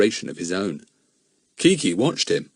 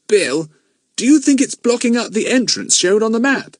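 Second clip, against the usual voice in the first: put-on higher boy's voice, worried; a boy's dismayed question, pitched up; anxious boy asking the man; 13 semitones higher, 5 dB louder than usual. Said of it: "asked Jack, dismayed".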